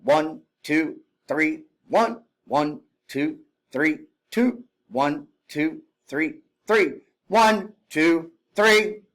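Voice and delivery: rising call on the final three; cadence count. Shown as a demonstration. Instructor counting exercise reps, final count rising. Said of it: "it sounds like this".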